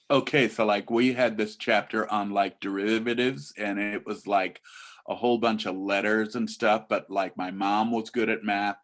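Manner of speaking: put-on low-energy teen rambling full of filler likes; monotone teen run-on; teenager. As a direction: imitate a teenager chatting loosely about class. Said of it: "And this is a teenager".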